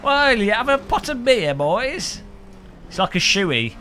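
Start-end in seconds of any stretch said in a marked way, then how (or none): 0.0-2.2 high hammy Aussie bloke; broad Australian twang, pitched up; Australian accent. Pretend. Mock Aussie bloke ordering beer.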